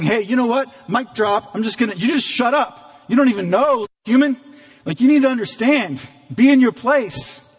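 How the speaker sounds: high, loud and dismissive, like shutting someone up; mock God putting a mere human in his place; harsh scolding God. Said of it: "And, and hear the tone here".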